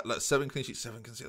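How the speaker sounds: ordinary delivery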